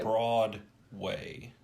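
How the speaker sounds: "Broadway" stretched slowly as broad... way; split into two words. Real, not acted. Correcting Broadway show to broad way.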